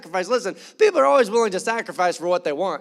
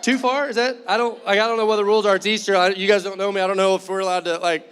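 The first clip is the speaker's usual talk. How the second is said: ordinary delivery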